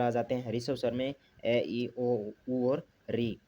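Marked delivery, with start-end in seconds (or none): none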